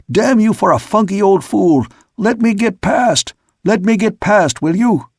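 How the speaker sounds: furious roar. A narrator's reading. Sailor roaring at Jaskett to let him pass, furious. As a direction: rage at him to move. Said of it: "I roared".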